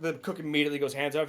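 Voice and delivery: ordinary talk, nothing marked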